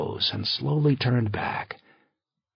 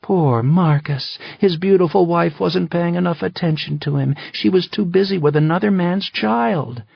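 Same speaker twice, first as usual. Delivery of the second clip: mocking; 8 semitones higher, 5 dB louder than usual. Wife mocking her jealous husband, high and sarcastic. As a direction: sneer at his self-pity.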